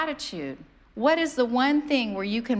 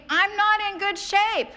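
mock dismay; 9 semitones higher, 3 dB louder than usual.

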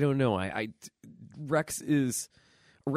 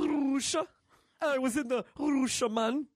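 a hammed-up fake Russian accent, pitched way up; mock Russian accent, much higher and goofy; bad Russian accent; 13 semitones higher than usual. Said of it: "That's my Russian accent".

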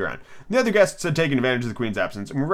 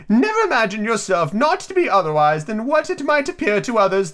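Duchess voice; 9 semitones higher than usual. Acted. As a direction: hold the Duchess's high sing-song. Duchess voiced in read story.

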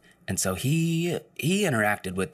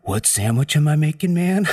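higher, louder clueless stoner; much higher clueless stoner voice; stoner coworker voice; 8 semitones higher, 7 dB louder than usual. Retold or imitated. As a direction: play a confused stoned coworker.

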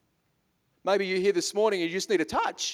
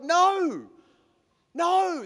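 emphatic no; 10 semitones higher than usual. Real, not acted. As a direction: shut the idea down hard. Rejecting the believers' works idea.

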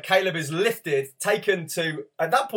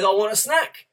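put-on higher, louder toddler demand; aggressive toddler; 5 semitones higher, 5 dB louder than usual. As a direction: insist like a stroppy little boy. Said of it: "a bit aggressive".

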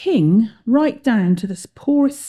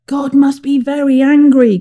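loud, raised captain's cry; 6 semitones higher, 7 dB louder than usual. A narrator's reading. Captain to the crew in a storm, crying out. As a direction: declare it loudly, pitched up. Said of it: "cried the captain".

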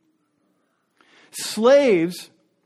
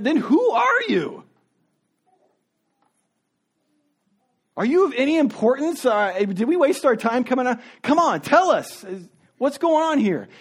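higher, mocking interrogators; exasperated officials in a higher voice; impatient leaders; 4 semitones higher than usual. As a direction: needle him, come on, tell us.

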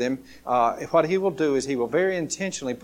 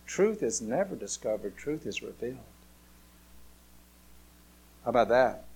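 ordinary talk

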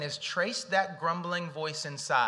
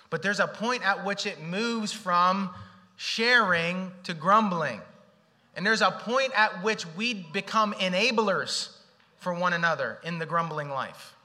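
preaching emphasis; 4 semitones higher, 6 dB louder, slower than usual. Acted. Preaching at full force.

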